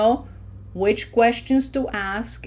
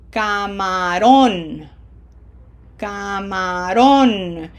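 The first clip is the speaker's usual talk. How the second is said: "Camarón" slow, final "-rón" pushed out louder; emphasis pushed with air; 4 dB louder, much slower than usual. Shown as a demonstration. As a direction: push more air into the last syllable of "Camarón". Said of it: "feel your lungs making more of an effort for that syllable".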